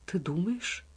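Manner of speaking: hushed, horrified question; secret horror. A narrator's reading. Salieri suddenly seized by secret horror, hushed dread. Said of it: "с вдруг охватившим его тайным ужасом".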